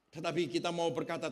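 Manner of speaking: ordinary delivery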